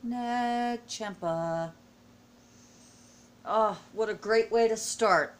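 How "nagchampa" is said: very slow, stretched-out Nagchampa; drawn-out savoring. Real, not acted. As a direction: draw the word out with relish. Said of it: "can you say Nagchampa".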